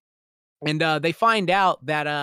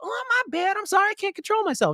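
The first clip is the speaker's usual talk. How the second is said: much higher, whiny mock apology; mocking fake apology. Imitating Dejanay's apology, high mocking voice. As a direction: mimic a fake-sorry brat. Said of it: "Dejanay is like".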